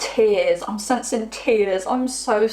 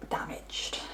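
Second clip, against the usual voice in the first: the word damaged breathed out unvoiced and much softer; whispered; 13 dB quieter than usual. Real, not acted. Reader gently naming the partner's wounds, whispered. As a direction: drop to a whisper on a sensitive word.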